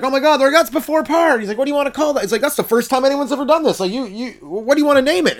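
high, amazed gush; excited golf crowd. Retold or imitated. Golf witnesses amazed at the first shot under par, high and gushing.

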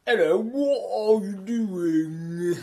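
very slow mock British, chewing the last syllable; slow chewed British accent. Shown as a demonstration. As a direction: drag out every word like a stage Brit. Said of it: "really slow down and chew, like, the last syllable".

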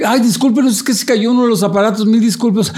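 high, hurried mock apology; acted flustered apology. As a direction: apologize profusely to startled diners. Acted flustered apology after staged crash.

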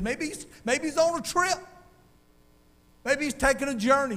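mocking taunt. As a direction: sneer that their god is away. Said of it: "he really made fun".

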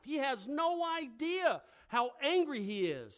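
ordinary delivery

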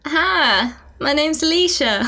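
put-on American accent. Podcaster goaded into trying Alicia's accent, American accent. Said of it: "do the entire podcast in American".

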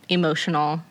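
usual speaking voice

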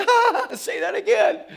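amused northerner asks the Louisiana man to repeat it; teasing city folk. Retold or imitated. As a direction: coo at the funny accent like it's adorable. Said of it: "And they're like".

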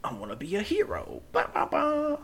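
lightly voiced eager kid hero; chirpy wannabe hero, then a high sing-song tune. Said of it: "where I'm like".

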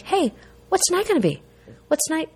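bright, lifted hopeful voice; hopeful upbeat. Retold or imitated. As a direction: sound eager about tonight. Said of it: "and go like".

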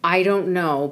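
usual speaking voice

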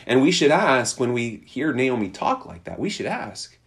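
ordinary talk, nothing marked

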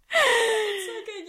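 crying with laughter; slow, squeaky wheeze of laughter; laughing. Real, not acted. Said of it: "I'm, like, dead".

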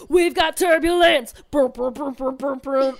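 loud, slow booming hype of a wild ride; loud mock roller coaster booms; mock hard rock. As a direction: belt it out like a thrashing rock album. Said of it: "the whole album's like".

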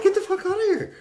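very high, loud screamed order; acted panic at the top of the voice; frantic yelling. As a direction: shriek it like an evacuation. Ordering friend away from ants.

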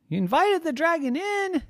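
very high, incredulous squeak; high squeaky disbelief; shocked kid. Acted. Friend's shocked question.

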